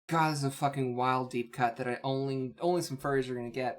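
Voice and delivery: ordinary talk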